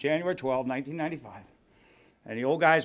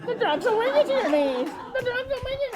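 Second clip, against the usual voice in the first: high, put-on lady voice with a laugh; mocking lady voice; 5 dB quieter than usual. Recalling the lady's warning, mocking high voice. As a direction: mimic the nagging lady, much higher.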